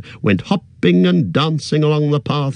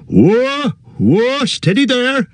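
put-on jolly old Tom calling out; 13 semitones higher, slower than usual. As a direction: rein them in like runaway ponies. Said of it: "cried the old man".

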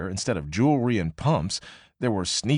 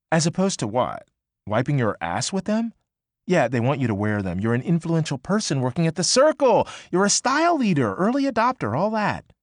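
a touch higher, teasing coworker; lightly voiced Annie; 7 semitones higher, 4 dB louder than usual. Annie ribbing May about the free jeans, lightly higher. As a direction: tease her, then puff her up.

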